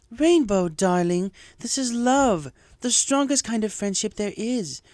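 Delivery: a touch of dismay; mildly shocked Rarity. A narrator's reading. Rarity gently chides Rainbow for her secrecy, a little shocked. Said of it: "a little shocked".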